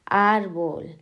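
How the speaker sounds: ÁRbol, a long, strong ÁR; stress on first syllable. Shown as a demonstration. Teacher marking llanas, árbol stressed on ÁR. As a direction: hit and stretch ÁR in árbol. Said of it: "en la penúltima".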